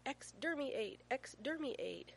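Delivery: put-on a toddler's battle cry, held back a little; lightly voiced yelling little boy; child's yell. A narrator's reading. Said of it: "Finn yelling".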